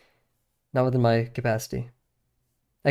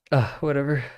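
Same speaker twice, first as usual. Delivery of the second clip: normal talking voice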